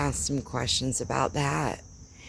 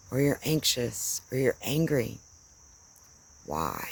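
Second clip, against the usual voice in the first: normal talking voice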